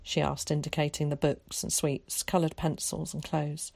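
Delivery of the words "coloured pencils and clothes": ordinary delivery, nothing marked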